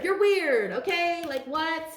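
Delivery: high, loud jab back at them; scornful retort. Pretend. Answering the haters, high loud scornful jab. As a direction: fling the insult back.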